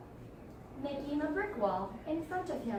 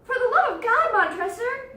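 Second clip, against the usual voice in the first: high, loud desperate cry; desperate plea; 12 semitones higher, 10 dB louder than usual. Acted. Fortunato begging Montresor through the wall, desperate.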